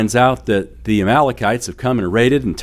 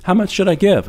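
lightly imitated young daughter, pitched higher; higher-pitched child's question; child asking; 9 semitones higher than usual. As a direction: ask like an eager little kid. Voicing his young daughter's question.

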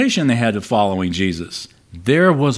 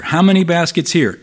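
higher and pushed, exasperated repeat; frustrated, pressing; 6 semitones higher than usual. Retold or imitated. Jesus pressing the disciples in the boat, exasperated. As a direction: hammer the question home impatiently. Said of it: "hear the frustration here in Jesus' voice".

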